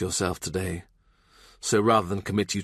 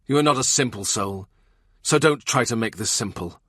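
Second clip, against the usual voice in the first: a raised, cutting rebuke; Cromwell, furious. Acted. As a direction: tear into him with rising anger.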